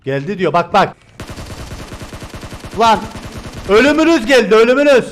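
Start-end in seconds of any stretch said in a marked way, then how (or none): 3.7-5.0 louder, higher taunting threat; hammed-up death threat; mock menace. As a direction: play the doom-bringer. Taunting enemies in game.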